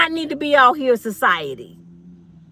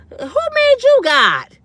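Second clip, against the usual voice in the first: high, loud and slow: who made you God; high, slow, loud indignant question; outraged shout; 11 semitones higher, 5 dB louder, much slower than usual. Acted. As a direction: demand it, outraged and drawn out.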